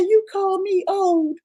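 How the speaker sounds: high, slow, outraged put-on voice; mock indignant woman's voice; imitating her offended reply. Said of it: "She said".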